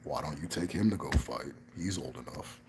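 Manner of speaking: low, soft elder's command; hint of a stern old man; granduncle's voice. Granduncle telling the nine-year-old dad to go fight, low and soft. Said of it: "my granduncle goes".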